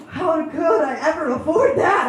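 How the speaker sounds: loud, high mock-shocked question; mock disbelief. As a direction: play the audience's fake outrage.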